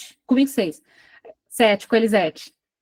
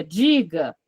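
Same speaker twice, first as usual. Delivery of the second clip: low flat "Diga"; 8 semitones lower than usual. Retold or imitated. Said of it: "Ele dizia".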